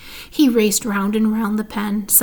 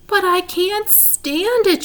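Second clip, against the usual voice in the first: high, slow anguished shout; 9 semitones higher, slower than usual. A narrator's reading. Wilbur shouting at Charlotte that he won't leave, anguished. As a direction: cry out that you can't bear it. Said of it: "shouted Wilbur".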